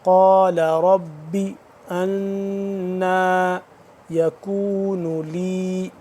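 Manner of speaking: قال with a thick ق and انا with a held nasal ن; slow chant, thick ق in قال, ghunna on انا; ghunna recitation. Shown as a demonstration. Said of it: "اس پہ ہم غنہ کریں گے".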